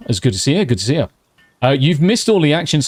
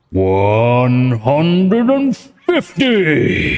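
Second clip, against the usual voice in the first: drawn-out excited cheer; 4 semitones higher, much slower than usual. Real, not acted. Streamer thrilled by a donation, stretched-out cheer. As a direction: savour each word of the amount in disbelief. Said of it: "have to do it slightly quietly".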